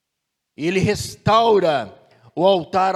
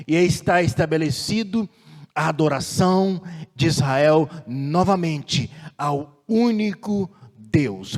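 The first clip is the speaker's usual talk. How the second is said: ordinary talk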